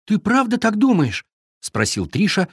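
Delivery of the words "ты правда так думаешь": tearful hopeful question. A narrator's reading. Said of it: "поднимая на викинга полные слёз глаза".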